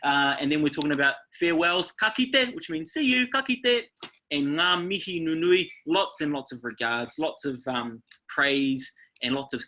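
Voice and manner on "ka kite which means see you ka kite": high, bright singsong "ka kite"; recapping the friendly farewell; cheerful farewell tune. Shown as a demonstration. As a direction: sing out the goodbye up high. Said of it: "that intonation in your voice".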